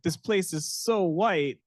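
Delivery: high, slow stunned remark; mock shock. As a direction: marvel at the sameness. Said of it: "I was like".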